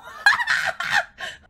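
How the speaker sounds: loud high cackle; high burst of laughter. Real, not acted. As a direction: let out a delighted cackle. Reacts to penal colony joke.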